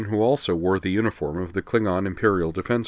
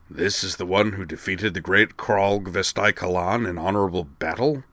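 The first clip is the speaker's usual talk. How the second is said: rumbling. Acted. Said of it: "Zarg rumbled".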